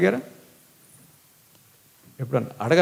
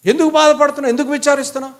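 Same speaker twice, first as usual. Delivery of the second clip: emphatic retelling; 8 semitones higher, 4 dB louder, faster than usual. Acted. Elkanah's tender words retold.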